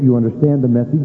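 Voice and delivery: ordinary talk, nothing marked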